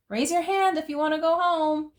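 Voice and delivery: high, loud mock yell at the class. A narrator's reading. Child yelling a cheeky prank to the whole class. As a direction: call it out to the room like a little rebel. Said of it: "I yelled".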